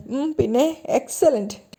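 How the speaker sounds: reluctant praise. A narrator's reading. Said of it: "കഷ്ടകാലത്തോടെ പറയാണ്".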